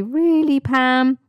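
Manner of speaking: a listener who can't believe it; mock disbelief pitched well up; doubtful really. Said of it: "when you question it and say".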